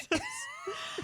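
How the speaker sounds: high shrieking cackle; witch cackle. Pretend. Witch cackle after joke.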